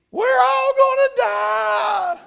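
put-on high, slow, loud panicked child's scream; little girl screaming. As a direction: wail it like a terrified little girl on the plane. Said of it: "she was screaming at the top of her lungs".

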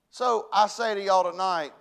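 usual speaking voice

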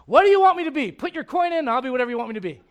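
loud, high, rushing at the end; hammed-up eager-to-please; mock people-pleaser. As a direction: sell yourself like a vending machine. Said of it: "I'm just kinda like".

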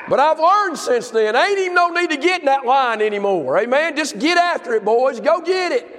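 full-throated revival preaching; preaching at full force. Acted. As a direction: preach it up to the congregation. Pastor urging men to work.

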